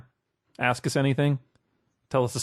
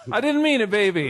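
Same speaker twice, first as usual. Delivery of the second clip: mock begging; 7 semitones higher, 3 dB louder than usual.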